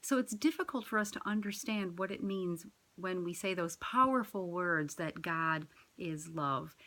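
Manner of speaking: ordinary talk, nothing marked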